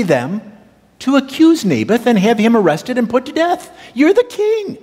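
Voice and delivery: a hammed-up goading queen, climbing to a squeal on the king; scheming queen Jezebel.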